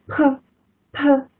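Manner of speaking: p，p as a crisp breathy unvoiced puff, slowly. Shown as a demonstration. Teacher demonstrating the voiceless p to students. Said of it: "P它是清清，清辅音".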